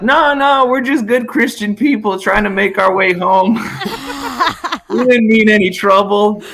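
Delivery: hammed-up fake innocence to avoid trouble; meek backpedaling guys. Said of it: "they're like".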